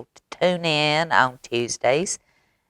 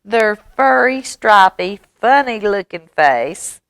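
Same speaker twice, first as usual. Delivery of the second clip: a higher, teasing little mouse; lightly voiced mouse; 6 semitones higher than usual. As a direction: point out features in a raised voice. Mouse describes another animal in story.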